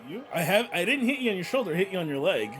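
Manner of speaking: ordinary talk, nothing marked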